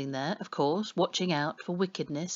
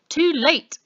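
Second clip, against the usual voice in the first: a loud, higher snapped retort; 10 semitones higher, 9 dB louder than usual. A narrator's reading. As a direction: cut her off sharply. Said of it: "snaps the policeman".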